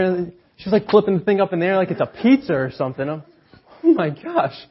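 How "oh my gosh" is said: shocked gasp. Retold or imitated. Watching nurse toss baby.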